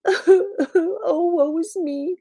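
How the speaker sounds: high, wailing mock cry; crying dragon. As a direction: wail like a storybook dragon in tears. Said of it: "cried the dragon".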